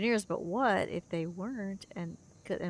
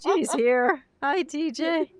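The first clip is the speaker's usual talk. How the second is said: laughing; 8 semitones higher, 8 dB louder, much slower than usual. Acted. Excited laughing greeting.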